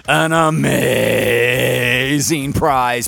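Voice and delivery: low slow announcer voice. Acted. Host hypes the prize.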